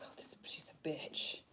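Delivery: whispered mock gossip; whispered imitation of a gossip; catty whisper. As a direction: hiss it behind someone's back. Imitating a judging person.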